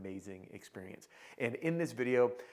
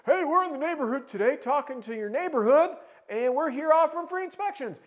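high, loud cheesy sales pitch; fake salesman voice; 9 dB louder than usual. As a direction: overeager door salesman, hammed up. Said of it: "you're like".

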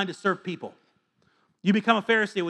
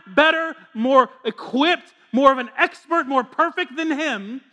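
louder, higher preacher voice, building; fiery sermon delivery; full-force preaching; 8 semitones higher, 7 dB louder than usual. Acted. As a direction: preach it out over the room.

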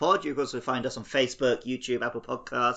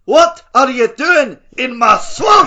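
mock Shrek voice; 11 semitones higher, 16 dB louder, much slower than usual. Shrek quote in Scottish voice.

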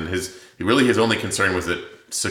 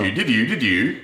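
higher, louder singsong mock of a character; Eric's voice; 6 semitones higher, 4 dB louder than usual. Cohost mocking the narrator's weird voice for the Viking, singsong parody. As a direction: spoof a weird character voice in nonsense syllables. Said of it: "she does Eric's voice".

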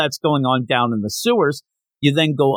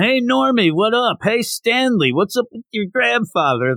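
high, bright taunting call across the crowd; mock yelling; 9 semitones higher than usual. Schoolkids calling out to Normie, high mock yell. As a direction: call out like rowdy schoolkids, much higher. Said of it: "Everybody's yelling".

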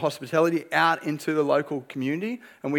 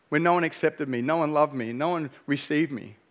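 ordinary delivery

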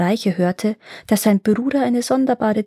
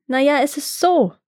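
higher-pitched, hesitant confession; sheepish admission; 6 semitones higher than usual. A narrator's reading. Iwan explains himself to the Sun, sheepish admission. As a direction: voice lifted, owning up to a mishap. Iwan admits his mishap.

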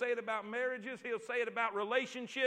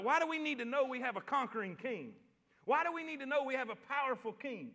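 ordinary talk, nothing marked

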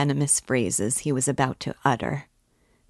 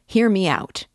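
loud, higher insistent demand; furious; 5 semitones higher, 6 dB louder than usual. A narrator's reading. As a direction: demand a hearing in a burst of fury. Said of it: "with redoubled fury".

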